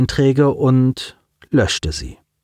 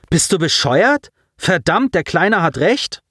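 exasperated outburst; 7 semitones higher than usual. Acted.

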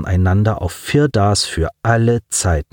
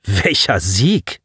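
scornful question; 2 semitones lower, slower than usual. Acted. Alexander scornful.

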